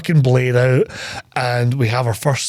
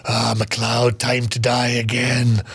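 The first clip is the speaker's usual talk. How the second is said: slow, lower villain threat; lightly growled impression of a menacing villain, drawn out; Kurgan; 3 semitones lower, much slower than usual. Kurgan taunts McLeod before a sword fight, slow mock menace. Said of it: "the Kurgan says something to him like".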